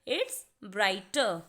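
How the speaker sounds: "brighter" said very slowly, silent r at the end; correct pronunciation. Shown as a demonstration. Teacher to students modelling the right reading, very slow. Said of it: "इसीलिए हम इसे पढ़ेंगे ब्राइटर".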